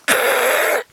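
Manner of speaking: high rasping crane squawks; crane croak. Retold or imitated. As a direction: croak repeatedly like a crane taking off. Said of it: "That's how he flies".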